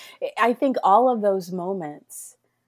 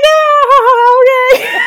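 laughing shout, much higher and louder; nervous laughter; 14 dB louder, much slower than usual. Retold or imitated. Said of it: "the nervous laughter".

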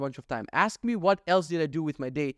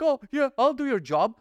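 high, loud mock eagerness; hammed-up sarcastic compliance; 9 semitones higher, 5 dB louder than usual. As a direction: sneer at the dutiful queue. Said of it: "waiting to be like".

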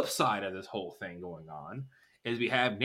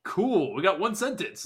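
higher, dry fake enthusiasm; imitating Steve's sarcastic cool, higher; mock sarcastic Steve; 8 semitones higher, 3 dB louder than usual. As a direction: deflate their excitement, high and sarcastic. Steve unimpressed by the kids.

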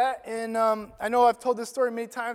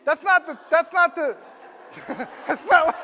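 higher, louder, laughing through the correction; laughing at himself; 8 semitones higher, 6 dB louder than usual. Real, not acted. Preacher to congregation, laughing at his own mistake. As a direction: laugh off the slip in the notes.

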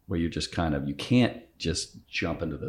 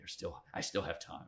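barely voiced, self-mocking aside; whispered joking aside; 12 dB quieter than usual. Real, not acted. To his co-host, joking he still has time for Hamlet, whispered. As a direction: toss it off under the breath as a joke. Joking he's not too old yet.

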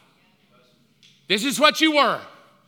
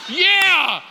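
high, loud rallying yell; men cheering agreement; 9 semitones higher, 5 dB louder than usual. Retold or imitated. All the men backing the husband, cheering shout. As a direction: whoop it like a crowd of men. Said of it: "all the men are like".

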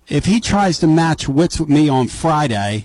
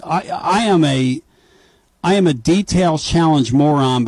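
normal talking voice

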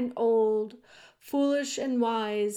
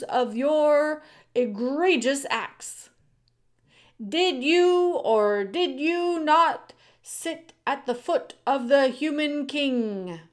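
raised, emphatic judge's voice; stern head mouse, formal; 6 semitones higher, 6 dB louder than usual. A narrator's reading. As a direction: address the accused before a packed court. Head mouse formally questioning the accused.

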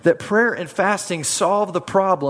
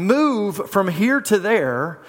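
slow, deliberate command to the mountain; 3 semitones higher, much slower than usual. Acted. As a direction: speak each word slowly, with authority.

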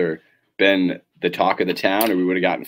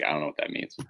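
much softer, clueless mumble; Owen Wilson; 3 semitones lower, 9 dB quieter than usual. Retold or imitated. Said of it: "Owen Wilson just being like".

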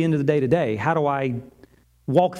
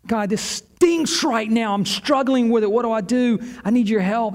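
higher-pitched, urgent appeal to God; pleading prayer; 5 semitones higher than usual. Acted. Struggling believer asking God for help right now, pleading. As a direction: cry out for help mid-struggle.

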